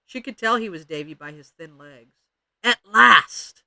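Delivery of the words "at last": loud, raised exclamation; triumphant cry. A narrator's reading. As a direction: burst out with it as you pounce. Ramona triumphantly pounces on Davy.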